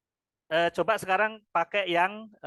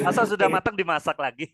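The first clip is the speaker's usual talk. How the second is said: laughing through the question, a bit louder; amused laughing question; 3 semitones higher, 5 dB louder than usual. Real, not acted. Teacher teasing students about cooking cooked rice, laughing. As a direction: ask it incredulously, breaking into laughter.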